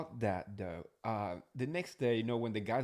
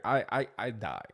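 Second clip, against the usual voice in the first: ordinary delivery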